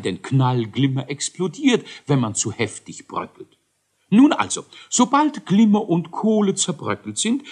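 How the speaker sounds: high, fussy professor explaining; lecturing scientist. Acted. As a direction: proudly explain the recipe like a quirky inventor. Elisius explains his lab.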